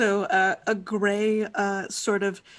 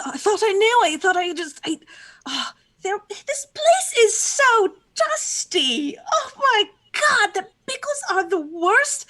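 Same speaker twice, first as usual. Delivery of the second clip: spiral into outraged panic; distressed outburst; 7 semitones higher than usual. Acted. Character reacts to her death.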